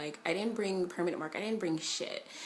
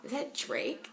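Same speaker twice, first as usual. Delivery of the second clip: higher, hushed disbelief; startled question; 7 semitones higher, slower than usual. Retold or imitated. Spotting [name] by the trailers, stunned. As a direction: ask it like you just spotted a star. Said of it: "I'm like".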